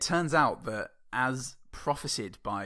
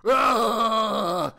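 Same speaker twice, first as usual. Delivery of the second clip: silly shout; 9 semitones higher, 10 dB louder, much slower than usual. Pretend. Shouting silly knight noises.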